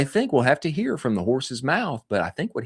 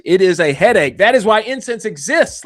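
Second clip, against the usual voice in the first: hyped pitch; 7 semitones higher, 10 dB louder than usual. Acted. Hyped ad read peak.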